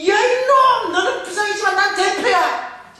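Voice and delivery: very high, loud, drawn-out yell; furious outburst. Retold or imitated. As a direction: scream the curse at someone who isn't there. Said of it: "터져나오는 거죠".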